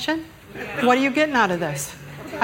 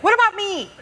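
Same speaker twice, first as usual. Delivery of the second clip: put-on much higher, plaintive kid voice; whiny child's catchphrase; 10 semitones higher, faster than usual. Shown as a demonstration. Mother showing church how to say it, high childish whine. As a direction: whine it like a little twin who got shortchanged. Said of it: "You kinda gotta say it like that".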